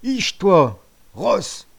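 loud yell at the horse. Acted. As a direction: bark it at the horse. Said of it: "gueulait-il". (crier sur le cheval)

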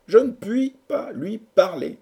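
high, slow, helpless protest; lovelorn lament. A narrator's reading. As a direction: draw out the complaint in a high voice. Lovesick hero laments to friends. (plainte lente, très aiguë)

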